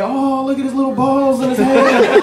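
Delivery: high-pitched teasing coo; mocking women. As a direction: imitate women cooing in mockery. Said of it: "All the females looking at me like".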